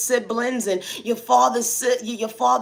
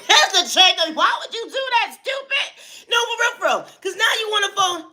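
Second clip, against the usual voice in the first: usual speaking voice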